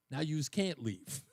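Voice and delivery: slow, low movie tough-guy line; Chaz Palminteri. Retold or imitated. Actor's character to a biker gang, slow menace. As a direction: deliver it slowly as a quiet threat. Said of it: "Chaz Palminteri says to the biker game".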